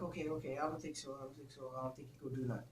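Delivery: placating. Retold or imitated. Woman soothing him before the confrontation, placating. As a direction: trail down into a hushed, appeasing murmur.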